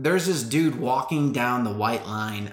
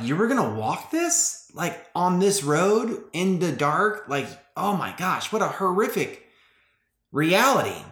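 higher-pitched retold disbelief; stunned inner reaction, lightly acted; can't believe what they're facing; incredulous; 6 semitones higher than usual. Inner reaction to her long walk.

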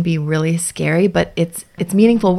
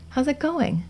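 gentle check-in; 9 semitones higher, 7 dB quieter, slower than usual. Shown as a demonstration. Gentle phrase offered to child.

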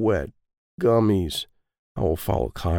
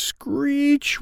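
high mock tire screech; vocal tire screech. A narrator's reading. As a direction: squeal it like braking tires. Tire screech sound effect.

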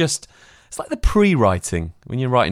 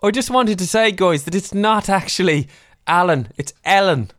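higher-pitched put-on Irish voice; Irish accent; 8 semitones higher than usual. Guest imagined correcting the hosts on her name, Irish accent. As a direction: politely correct them in a mock Irish lilt. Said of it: "Aspen Weight Radio's got an Irish accent".

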